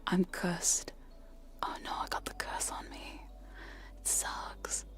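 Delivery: soft breathy-free close whisper into the mic; ASMR whisper. Acted.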